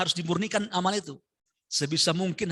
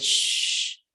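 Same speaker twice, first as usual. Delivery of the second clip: wind effect hiss. Retold or imitated. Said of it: "Karena efek angin dia".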